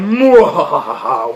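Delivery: slow drawn-out villain laugh; evil cackle. Retold or imitated. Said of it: "men with suits in some dark corner going".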